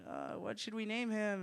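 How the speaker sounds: slow, high, soft put-on voice; timid drawn-out question; uneasy parent. Couple discuss baby's name.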